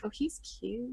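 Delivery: very soft distracted aside; trailing off, smitten. Player leaning over to look at phone pictures, trailing off softly. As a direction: let it drop to a soft, admiring murmur. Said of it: "trailing off a little".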